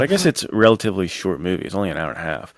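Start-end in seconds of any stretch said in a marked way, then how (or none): none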